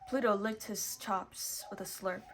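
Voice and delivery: usual speaking voice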